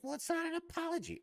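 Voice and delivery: very high, sneering nitpicker voice; mock offended critic. Mocking people who say it's no apology, very high and whiny. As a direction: squeak it like a whiny complainer. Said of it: "people are like".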